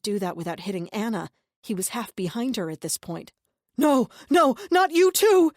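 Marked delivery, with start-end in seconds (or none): 3.8-5.5 high, loud, panicked cry; terrified scream. A narrator's reading. Deluded brother screaming in terror at Angel. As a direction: shriek in terror.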